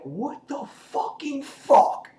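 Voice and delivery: high, slow drawn-out shock; Negan stunned. Retold or imitated. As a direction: stretch the disbelief out high and slow.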